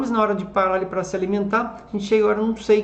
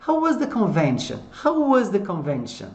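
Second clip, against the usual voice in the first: "How" pitched high, falling to "convention"; stress on HOW; 3 semitones higher than usual. Shown as a demonstration. Teacher models asking about the convention, stress on "How". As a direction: lift "How" and let the question descend. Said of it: "a entonação tá nas palavrinhas com W H".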